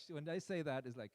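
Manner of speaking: normal talking voice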